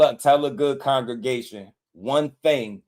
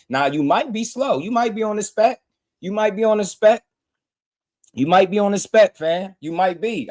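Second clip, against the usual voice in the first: taunting; 9 semitones higher than usual. Real, not acted. Taunting a viewer.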